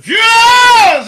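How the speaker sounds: high, very loud, stretched-out holler; exclaiming shout. Real, not acted. Host counting down to Friday's end, hollering. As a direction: blast it out and hold the vowel. Mock cheering about the coming weekend.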